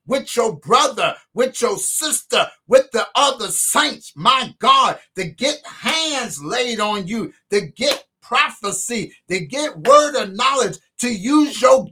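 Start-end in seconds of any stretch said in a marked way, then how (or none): none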